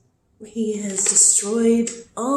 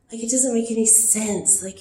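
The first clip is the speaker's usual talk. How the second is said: ordinary talk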